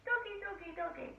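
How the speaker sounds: imitating a driver calling a dog in a high, coaxing voice; mock calling a dog. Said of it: "He kept calling Max".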